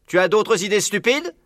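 high-pitched pointed question, at normal pace; sneering question. A narrator's reading. Buns needling Bean, challenging question. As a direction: ask it high, rising at the end.

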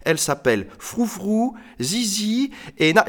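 put-on high-pitched boy's voice; lightly voiced boy, cut off mid-name; boy presenting pets. Boy introduces his mice to lady.

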